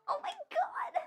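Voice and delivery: high excited shriek. Real, not acted. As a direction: shriek with sudden delight.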